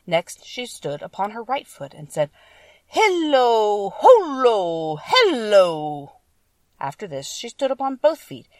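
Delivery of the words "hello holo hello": loud, long-drawn magic words, each sliding downward; witch's incantation. A narrator's reading. Witch on her right foot casting the charm, loud drawn-out incantation. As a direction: call out the spell, stretching every word.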